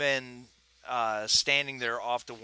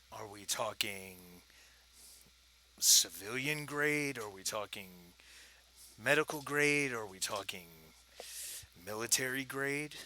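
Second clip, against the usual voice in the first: low gravelly voice; 4 dB quieter than usual. Acted. Dealer sizing up what buyers want, low gravelly voice. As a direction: draw out each option, dropping soft between grades. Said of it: "he's got that low gravelly voice".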